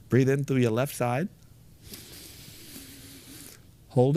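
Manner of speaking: calm instructions with long pauses; breathing guide. Acted. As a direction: lead the breaths step by step.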